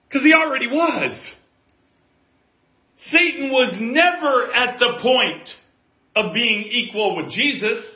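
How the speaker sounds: emphatic shouted sermon; full-force preaching. Real, not acted. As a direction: hammer each point home with pauses between.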